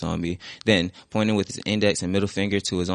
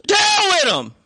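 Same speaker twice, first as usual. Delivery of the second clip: much higher, louder scream of hurt; 6 dB louder, slower than usual. Acted. Will screams at the door his father left through, a raw hurt shout. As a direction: hurl it at the empty door. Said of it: "screamed towards the empty door".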